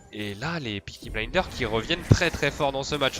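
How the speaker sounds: normal talking voice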